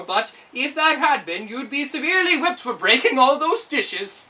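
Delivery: put-on loud, cheerful, kindly old wizard; a pleased trickster explaining his lesson; jovial Wizard of Oz.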